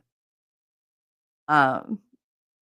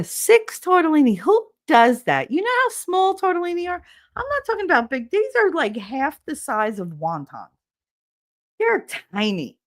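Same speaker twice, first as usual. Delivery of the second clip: outraged disbelief; 10 semitones higher, 4 dB louder than usual. Real, not acted. Outraged about tiny portions.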